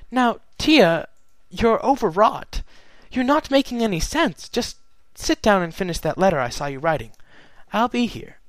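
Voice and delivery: calm her down, a bit rattled; nervous Luna. Acted. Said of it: "Luna laughed nervously".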